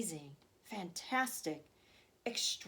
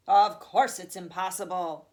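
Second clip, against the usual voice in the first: louder emphatic exclamation; loud cry; 9 dB louder than usual. Acted. Grandfather answering Charlie's doubt, crying out louder. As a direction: insist with relish. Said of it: "cried Grandpa Joe".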